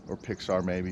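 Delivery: ordinary talk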